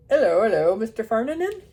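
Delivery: loud, hearty farmer greeting; a hint of a cheery Yorkshire farmer. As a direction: call out like a jolly farmer. Farmer Bert Sharp greets vet.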